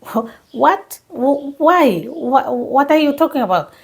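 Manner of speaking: stammering in disbelief at the news; confused. Retold or imitated. To a friend on the phone, caught off guard and confused. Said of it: "And I was confused".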